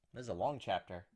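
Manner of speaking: ordinary talk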